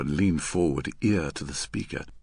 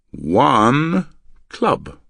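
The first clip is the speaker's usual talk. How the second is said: One drawn out long, loud and lilting; lilting emphasis on One; 5 semitones higher, 9 dB louder than usual. Acted. Man signalling his partner in code, lilting long on One. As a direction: stretch the word One with a lilt. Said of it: "curious lilting emphasis on the word one".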